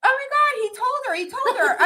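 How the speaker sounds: high-pitched imitation of a giddy girl. Girl reacting to mom at the car window, giddy. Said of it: "she starts laughing, and she's like".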